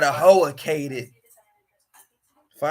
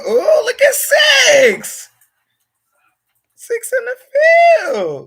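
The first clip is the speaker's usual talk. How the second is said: slow, high, hyped shouting; excited shout; slower than usual. Real, not acted. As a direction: call it out like a hype man. Hyping a friend's hat.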